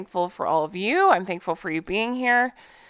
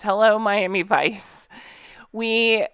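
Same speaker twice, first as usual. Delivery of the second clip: ordinary talk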